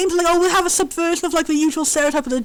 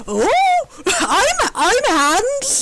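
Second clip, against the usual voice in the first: Doctor Snap voice; 6 semitones higher, much slower than usual. Retold or imitated. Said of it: "leans in like".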